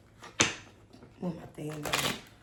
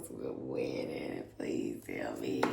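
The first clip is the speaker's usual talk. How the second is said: breathy whisper to the cards; 11 dB quieter than usual. Real, not acted. Tarot reader asking the spirit, whispered. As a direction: murmur the plea under your breath.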